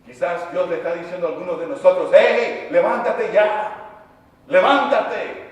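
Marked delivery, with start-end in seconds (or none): 2.1-5.5 commanding call. Acted. God ordering someone to rise.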